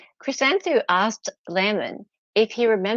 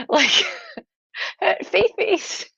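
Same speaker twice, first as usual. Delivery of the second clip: giggling, slow and high through the words; real laughter bubbling over the silly word; laughing; 11 semitones higher than usual. Real, not acted. Laughing at her own joke name, slow and high. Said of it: "I just thought the term was funny".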